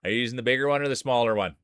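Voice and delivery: usual speaking voice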